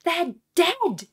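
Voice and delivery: high, very loud yell; anguished, high-pitched outcry; desperate shout. A narrator's reading. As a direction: shout it back at them with full force. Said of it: "Hassan was shouting at them".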